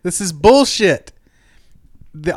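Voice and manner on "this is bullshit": higher, louder, drawn-out protest; acted outraged scream, stretched out; mock scream. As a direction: shout it slowly like a ringside heckler. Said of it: "and screams".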